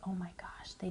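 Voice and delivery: a startled, hushed whisper; surprised whisper. Retold or imitated. Noticing missing braces on the show, whispered shock. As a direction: gasp it under your breath. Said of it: "I said".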